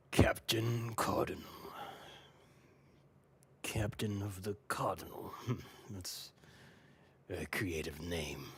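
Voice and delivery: slow, whispery menacing pirate voice; mysterious pirate captain. Acted. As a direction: savour each word in a hushed pirate rasp. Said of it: "he looks, uh, to specifically Captain Cardinal".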